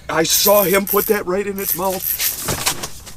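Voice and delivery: high, loud thrilled cry; excited shout. Real, not acted. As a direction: yell out with joy at the catch. Excited fish catch reaction.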